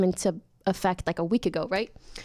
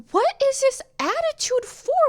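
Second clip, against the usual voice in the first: sassy retort. Retold or imitated.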